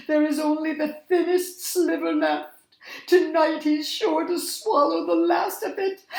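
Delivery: whiny worried creature voice; pouting, upset. A narrator's reading. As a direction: complain with a pout, high and upset. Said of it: "they said, pouting".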